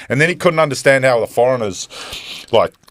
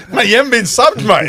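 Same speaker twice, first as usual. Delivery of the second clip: baffled protest; 9 semitones higher, 4 dB louder than usual. Retold or imitated. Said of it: "I was like".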